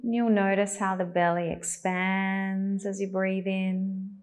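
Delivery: calm, even cues with long pauses; unhurried, guiding attention to the breath; guided meditation. Acted.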